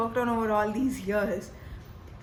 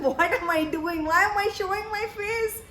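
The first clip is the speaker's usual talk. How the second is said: lightly voiced puzzled cats, higher pitch; mock wondering, pitched up; 6 semitones higher than usual. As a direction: playfully voice the pets' question, rising higher. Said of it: "They're wondering".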